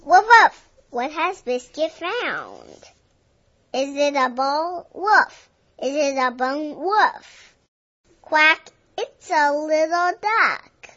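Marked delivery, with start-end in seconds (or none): 0.0-0.7 two bright high barks, loud; excited puppy woofs; playful dog bark. Acted. Dog barking in story. 4.9-5.5 a single high bark; short puppy woof; playful dog bark. Acted. Dog barking in story. 6.8-7.5 a single high bark; short puppy woof; playful dog bark. Acted. Dog barking in story. 8.2-8.7 a loud nasal-free quack; sudden duck call. Acted.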